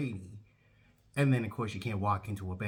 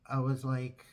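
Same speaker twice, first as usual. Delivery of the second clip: usual speaking voice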